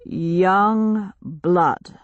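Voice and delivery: slow, grave stranger voice; slow solemn chief. Acted. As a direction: repeat the name like a village elder. Leader repeats her name.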